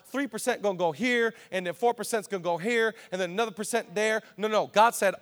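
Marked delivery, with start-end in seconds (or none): none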